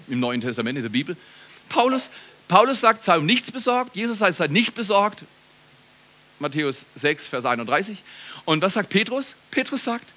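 ordinary talk

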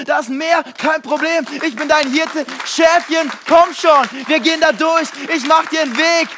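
fiery preacher voicing God's encouragement; preaching at full force. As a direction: build to a roaring climax. Preacher voices God, building.

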